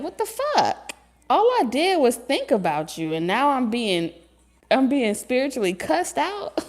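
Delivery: high indignant squawk, mock hurt; shocked outrage. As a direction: yelp it high like you got cussed out for nothing. Confused person voiced.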